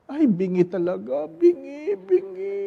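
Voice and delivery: mock wail climbing very high; hammed-up lament. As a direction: bemoan it, rising to a singsong wail. Husband acted lamenting wife is deaf.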